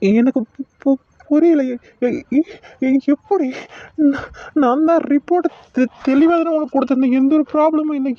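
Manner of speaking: stuttering, breaking off on each word; frightened stammer. Acted. Shaken doctor stammers as he is accused, frightened. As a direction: trip over every word in panic.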